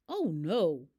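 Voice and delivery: a sudden loud gasp of dismay; the vet hears bad news; shocked oh no. A narrator's reading. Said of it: "said in shock".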